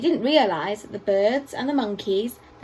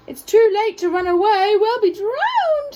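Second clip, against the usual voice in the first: much higher, louder panicked squeak, slowing at the end; tiny mouse squeaking in fear; 8 semitones higher, 6 dB louder than usual. A narrator's reading. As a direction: squeak it like a frightened little mouse. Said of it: "squeaked the mouse".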